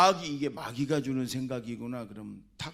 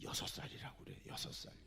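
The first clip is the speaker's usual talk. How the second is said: sneaky whisper; 5 semitones lower, 13 dB quieter than usual. Retold or imitated. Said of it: "일곱 살짜리를".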